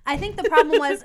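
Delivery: laughing as the words come out. Real, not acted. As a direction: giggle through the start of a confession. Laughs before embarrassing admission.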